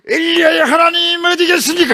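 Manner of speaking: high, loud, demanding cry; angry shout. Acted. Elisha striking the Jordan with the mantle, demanding shout. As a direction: shout it at the river as a challenge.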